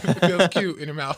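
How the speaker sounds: laughing. Real, not acted. Laughing while joking about jerky.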